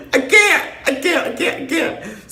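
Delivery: very high, squeaky words through laughter; real laughing fit. Real, not acted. Storyteller cracking up at own act, real laughing fit.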